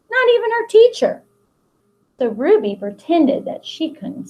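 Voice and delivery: put-on reading a picture book aloud to children; expressive picture-book read-aloud; storybook narration. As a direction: tell the story to the class with long pauses.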